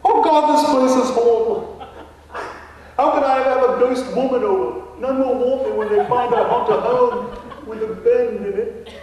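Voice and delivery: hammed-up English gentleman ghost; mock English accent. Ghost Nigel answers the realtor in a fake English accent. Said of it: "a Texan trying to emulate an Englishman".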